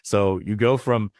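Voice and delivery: usual speaking voice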